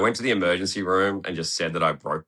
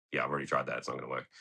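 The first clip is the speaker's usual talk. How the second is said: quiet, resigned inner thought, much softer; 6 semitones lower, 9 dB quieter, much faster than usual. Retold or imitated. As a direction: mutter it to yourself as if no one hears. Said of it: "in my head, I'm like".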